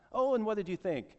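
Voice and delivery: high-pitched, inquisitive missionary imitation; missionary's keen question. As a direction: mimic an expectant questioner, voice lifted.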